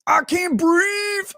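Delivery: high, slow strangled mock voice; retelling the subway victim, strained and high; mock choked gasp. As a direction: squeeze it out like being choked. Imitating the choked man.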